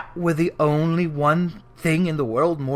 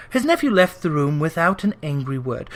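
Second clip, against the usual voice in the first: plain reading voice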